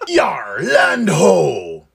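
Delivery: shouted pirate call from the deck. Pretend. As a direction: bellow it like an old sea pirate. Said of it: "I didn't even know I could do a pirate voice that good".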